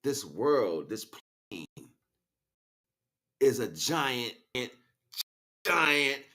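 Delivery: ordinary talk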